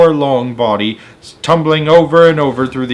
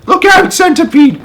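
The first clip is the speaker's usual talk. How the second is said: much higher, louder alarmed shout; cry of warning; 7 dB louder than usual. Acted. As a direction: yell to warn him he's falling. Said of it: "cried James".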